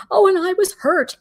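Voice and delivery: high, overdramatic mock hurt; mock put-on act. Mimicking a witness's big act on the stand, mock hurt. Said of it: "she had this big act going on".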